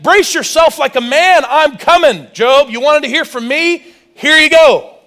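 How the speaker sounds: loud, high booming challenge, rising to a shout; God's verbal beating. Acted. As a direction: hurl it at Job like thunder. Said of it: "a verbal beating".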